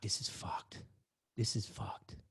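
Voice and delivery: a tense, hushed whisper; panicked whisper. Retold or imitated. The narrator whispers to himself in dread amid the chaos. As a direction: whisper it under your breath in dread.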